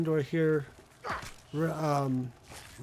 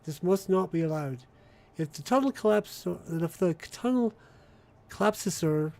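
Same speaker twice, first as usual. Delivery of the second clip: put-on British accent with Australian A's; mock-official British public notice; British announcement. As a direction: read the bulletin as a British broadcaster. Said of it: "My A's are all Australian, while my, the rest of it's British".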